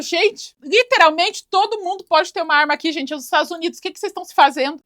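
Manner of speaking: high, alarmed pleading; panicked. Retold or imitated. (segurança apavorado alerta todos, voz aguda e aflita)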